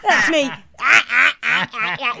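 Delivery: loud cackling laugh; silly cackle. Pretend. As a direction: cackle like a bawdy comic. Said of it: "That's the laugh she was doing".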